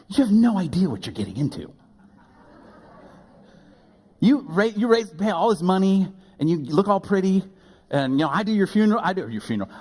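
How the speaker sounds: flustered laugh. Real, not acted. Pastor correcting his funeral slip, flustered and high. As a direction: cover the slip in a rush.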